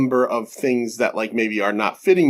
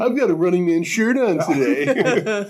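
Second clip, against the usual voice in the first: higher mocking voice through a laugh; Larry impression; 5 semitones higher than usual. Host teasing co-host over his shirt, mocking impression. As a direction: caricature your friend, amused and pitched up. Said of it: "I'm not talking like that".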